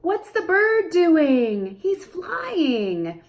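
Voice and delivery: high slow parentese; high very slow singsong; slow question and answer. Shown as a demonstration.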